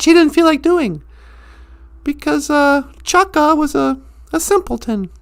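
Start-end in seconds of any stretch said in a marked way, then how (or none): none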